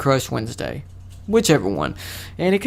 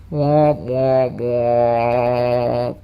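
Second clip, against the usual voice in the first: slow, sinking womp-womp-womp; mouthed sad trombone, last note held long; 3 semitones lower, much slower than usual. Pretend. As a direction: imitate a deflating failure horn. Imitates sad trombone after self-pity.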